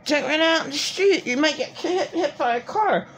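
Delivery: warning mother. Retold or imitated. Mother warning her child about the street, lightly mimicked. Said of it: "that mother cursed her son or daughter".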